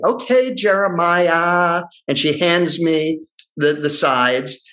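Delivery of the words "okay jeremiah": slow, drawn-out indulgence; humoring. Retold or imitated. Receptionist going along with supposed method actor, humoring him. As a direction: stretch it out, placating him. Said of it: "she's humoring me".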